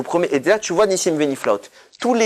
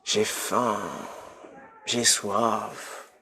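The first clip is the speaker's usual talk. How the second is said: softer, a half-whispered moan of hunger; mock complaining faster; 4 semitones lower, 10 dB quieter, much slower than usual. Worshipper moaning all Yom Kippour about hunger, soft mock whine. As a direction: sigh it like a grumbling faster. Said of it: "je la passe à dire".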